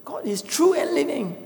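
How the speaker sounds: high, shouted outrage at his wife; angry yell. Acted. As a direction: cry out in disbelief and anger. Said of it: "was angry and yelled at his wife".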